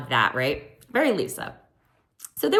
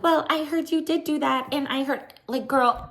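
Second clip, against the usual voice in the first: high put-on gossip voice; mimicking Lisa; 9 semitones higher than usual. Imitating Lisa repeating what she heard, high mock voice. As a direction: imitate her in a raised, held pitch. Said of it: "so then Lisa was like".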